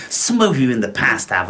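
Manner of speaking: ordinary delivery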